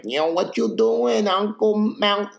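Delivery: mock gangster calling out to his uncle; calling out Jersey style. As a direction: call out to the uncle, mobster style.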